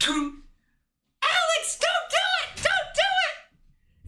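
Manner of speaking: very high, slow hammed-up shriek of don't do it; mock desperate yell. Kat begging Alex not to jump, hammed-up high slow shriek. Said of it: "And Kat runs up".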